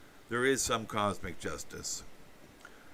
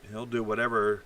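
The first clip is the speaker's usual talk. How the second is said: normal talking voice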